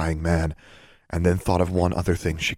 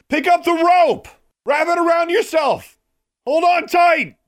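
high, loud shouted commands; yelled; 6 dB louder than usual. A narrator's reading. Heroine orders girl below to grab rope, yelling. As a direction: shout urgent orders down a cliff. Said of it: "Alexandra yelled".

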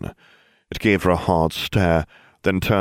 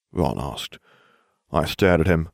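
usual speaking voice

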